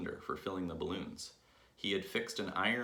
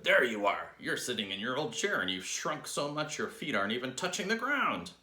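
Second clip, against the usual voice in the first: loud start, climbing higher to the end; triumphant shout; 6 semitones higher, 6 dB louder than usual. A narrator's reading. Husband pointing at wife in her chair, shouting. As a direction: shout it accusingly, pitch rising as you go. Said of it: "pointed at her and shouted".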